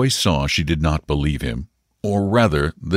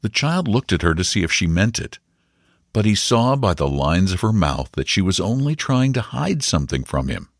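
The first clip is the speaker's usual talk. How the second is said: ordinary delivery, nothing marked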